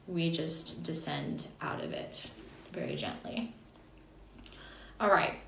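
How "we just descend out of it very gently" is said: gentle descent. Shown as a demonstration. Mimics the word's gentle relaxing descent.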